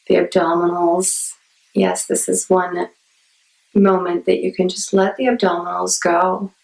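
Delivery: unhurried meditation guide with long pauses; guided meditation. Acted. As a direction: ease listeners into calm, phrase by phrase.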